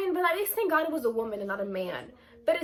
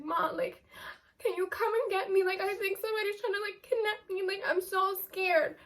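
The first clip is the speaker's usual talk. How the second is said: scared call to mom; 5 semitones higher, 4 dB quieter than usual. Retold or imitated. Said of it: "I was like".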